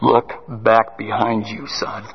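low, slow divine voice with a soft finish; voice of God. Retold or imitated. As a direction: speak as God, grave and unhurried. Voice of God answering.

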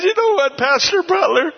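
high-pitched, tickled address; amused cop. Retold or imitated. Officer letting the pastor off, high and amused. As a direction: imitate an officer won over and amused. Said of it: "he's laughing".

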